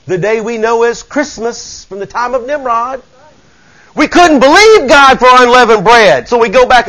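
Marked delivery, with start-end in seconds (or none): 3.9-6.9 rising preacher's cry; fervent preaching. Acted. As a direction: preach it with fire to the church. Preaching at full force.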